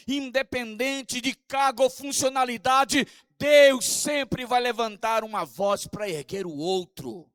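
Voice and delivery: climbing pulpit fervor; fervent preaching. Acted. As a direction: preach it harder each line. Pastor preaching on prophetic voice.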